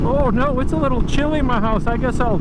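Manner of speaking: high, fast whiny mockery; whiny mock complaint. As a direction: whine like a spoiled complainer. Mocking people who complain about cold.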